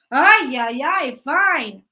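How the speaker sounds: loud fed-up sigh of words; exasperated outburst. Acted. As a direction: give in, loudly annoyed. Death gives in, exasperated.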